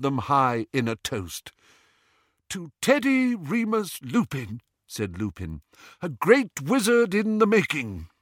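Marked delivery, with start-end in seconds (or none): 2.5-4.6 high, ringing proclamation; proposing a toast. A narrator's reading. New father toasting his son, proudly proclaiming. 6.0-8.1 high, proclaimed with pride; proud toast. A narrator's reading. New father toasting his son, proudly proclaiming.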